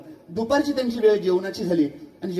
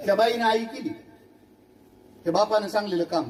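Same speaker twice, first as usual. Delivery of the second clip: ordinary delivery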